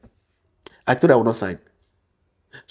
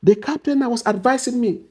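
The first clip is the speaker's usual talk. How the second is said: usual speaking voice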